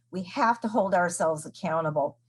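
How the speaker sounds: ordinary delivery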